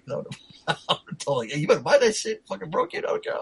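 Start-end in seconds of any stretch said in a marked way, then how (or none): 1.5-3.3 high-pitched mock demand; pitched-up, playful scolding; mock telling-off. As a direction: lay down the law, half joking. Imagined telling off of clumsy friend.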